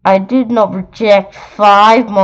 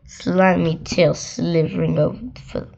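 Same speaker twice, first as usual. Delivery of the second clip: plain reading voice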